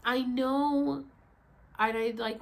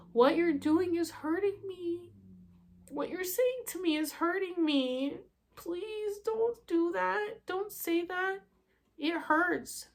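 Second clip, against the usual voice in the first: high, slow, pleading appeal; retold hurt plea; 8 semitones higher, slower than usual. Daughter reliving pleading with mom in her room, high hurt plea. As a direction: plead slowly, raised in pitch.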